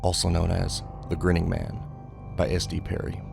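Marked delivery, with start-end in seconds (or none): none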